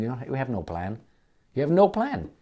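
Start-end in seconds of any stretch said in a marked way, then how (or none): 1.5-2.3 indignant. Real, not acted. Heated rant at politician.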